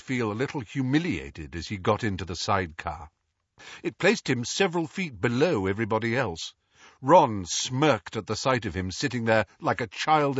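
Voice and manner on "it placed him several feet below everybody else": usual speaking voice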